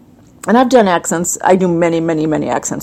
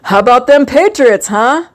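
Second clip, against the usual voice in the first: higher, imitated Boston accent; 5 semitones higher, 3 dB louder than usual. Host speaking of Boston and the Patriots, Boston accent. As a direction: do a mock Boston sports fan accent. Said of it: "speaking of Boston".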